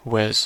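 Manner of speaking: Was said as a short wəz; quick wəz for Was; weak form of was. Shown as a demonstration. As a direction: reduce Was to a schwa. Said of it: "was رو با صدای ا بگید".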